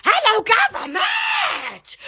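slow, high drawn-out hello; mock greeting shout. Real, not acted. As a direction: call it out like an announcement.

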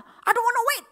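high, loud refusal; mock angry. Angry guest refuses to wait, high loud refusal. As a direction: refuse like an impatient boss. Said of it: "Dia marah".

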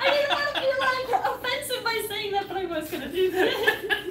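high, squealing through laughter; giggly, high-pitched confession; laughing. Real, not acted. Cracks up at the accent.

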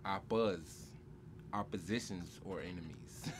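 ordinary delivery

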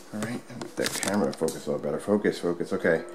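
low aside, barely audible; muttering to himself. Real, not acted. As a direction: mutter it under your breath while adjusting. Muttering to himself about camera focus.

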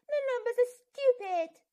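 put-on frustrated crying child; wailing. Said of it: "he wailed".

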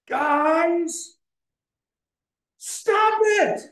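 very high, strained mock yell; Honest Preacher. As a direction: cry out in exaggerated frustration, word by word. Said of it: "crying out in frustration".

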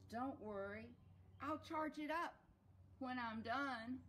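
high, loud character voice; reassuring donkey. Acted. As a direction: call it out high and loud, slowing at the end. Donkey's reply in story dialogue.

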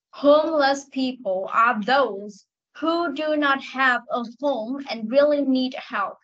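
slow, careful model reading. Shown as a demonstration. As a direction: read it slowly for the pupil. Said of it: "Nghe này". (đọc mẫu chậm rãi)

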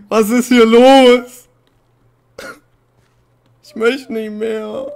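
drawn-out high screams, slow and very loud; terrified screaming. Real, not acted. Gamer finding a corpse on the server, screaming in terror. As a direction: scream each word out in panic. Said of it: "Ich hab so Angst".

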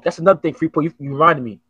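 normal talking voice

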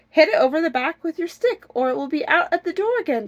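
high, loud storybook wife; urgent urging. Acted. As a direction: urge your husband to act at once. Wife urging husband to strike.

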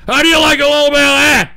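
fast, loud jeer; taunting yell. Real, not acted. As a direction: fire it off loudly as a taunt. Taunts the audience.

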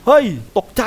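high, loud startled yelp; real jump scare in a game. Real, not acted. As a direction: jump out of your skin.

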